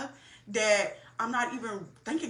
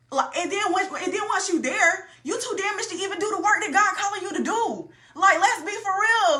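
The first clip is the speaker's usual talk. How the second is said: worked up; 9 semitones higher, 6 dB louder than usual. Real, not acted. Emphatic point to viewers.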